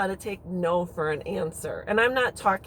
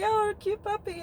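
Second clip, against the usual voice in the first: put-on much higher, softer baby-talk coo; cooing at a puppy; 12 semitones higher, 4 dB quieter than usual. Bending down to greet a stranger's dog, cooing. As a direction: gush over a cute dog.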